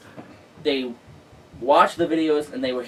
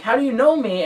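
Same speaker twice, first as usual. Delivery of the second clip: higher, astonished asking; surprised question; 10 semitones higher, 4 dB louder than usual. Retold or imitated. Reporter asking the drivers, surprised. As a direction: ask it in happy disbelief.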